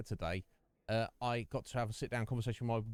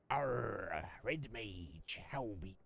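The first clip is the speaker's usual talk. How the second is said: whispered, softer mock pirate greeting; 3 semitones lower, 6 dB quieter, much slower than usual. Streamer greets a chat viewer as a pirate, whispering softly. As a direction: hush it out like a pirate.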